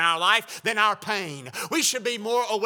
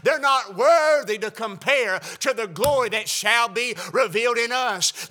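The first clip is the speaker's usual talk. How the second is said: usual speaking voice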